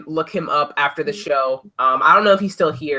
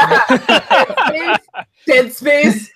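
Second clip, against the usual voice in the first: laughing; 11 semitones higher, 7 dB louder, much slower than usual. Real, not acted. Laughing joke about delays.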